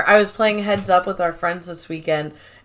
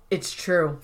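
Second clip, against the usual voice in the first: mock deadpan; 4 semitones lower, 7 dB quieter than usual. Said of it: "Dwight just goes".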